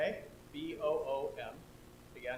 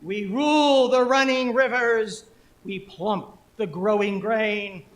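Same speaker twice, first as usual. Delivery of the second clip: loud, slow, elevated chant; poem performance; 3 semitones higher, 11 dB louder, slower than usual. Acted. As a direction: proclaim it grandly with drawn-out vowels. Performs the poem as rain gods.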